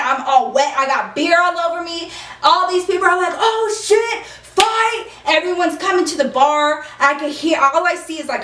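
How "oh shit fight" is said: high mock crowd cry; excited crowd. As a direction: imitate onlookers calling out a fight. Voicing excited bar crowd at fight.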